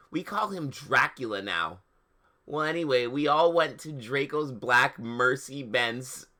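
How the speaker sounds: ordinary delivery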